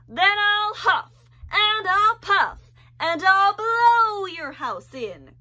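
loud, high, slow wolf threat; menacing storybook wolf; growling wolf. Acted. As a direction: roar each word out. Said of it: "growled the wolf".

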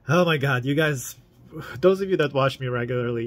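excited outburst. Real, not acted. Exclaiming to viewers.

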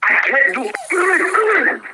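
real losing gamer's wail; exasperated outburst. Real, not acted. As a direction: drag the words out in helpless frustration.

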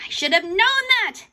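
loud, high exclamation; frustrated outburst. A narrator's reading. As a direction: burst out annoyed at yourself. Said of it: "slamming her fist against the table".

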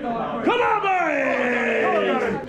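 high, loud call to the team; rally the team before kickoff; rallying shout. Real, not acted. Calling out to teammates.

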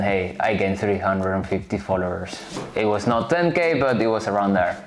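ordinary talk, nothing marked

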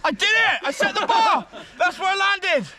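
cheering after landing the jump; triumphant cheer. Real, not acted. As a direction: crow about landing it. Lands the challenge, cheering.

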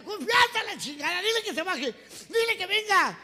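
high, loud ordering; hammed-up furious command; angry father. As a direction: demand it in a strained squeal. Father orders daughter brought down.

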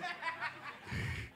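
a high rueful giggle; rueful laugh. Real, not acted. Laughing at lost money.

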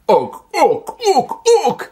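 loud, high-pitched mock caveman grunting; caveman grunts. Reviewer mimicking cavemen before language existed, exaggerated loud grunts. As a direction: grunt loudly like a hammed-up caveman. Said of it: "you wouldn't want them going".

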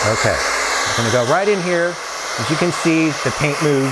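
talking over a running blow dryer; over hair dryer. Real, not acted. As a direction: speak up over dryer noise. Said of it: "I'm gonna turn the blow dryer on again".

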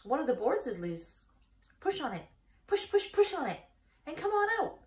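high, loud, fast honking goose; chattering goose. Acted. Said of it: "who talked rather fast".

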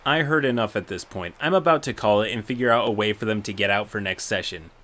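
usual speaking voice

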